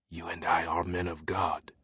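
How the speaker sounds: put-on soft hushed French accent; whispered. A narrator's reading. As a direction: lean in close and hush it. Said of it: "the teacher whispered".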